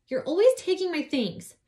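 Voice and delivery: high, loud angry complaint; angry accusation. Acted. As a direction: snap it at your stepsister. Abby glares at Miranda.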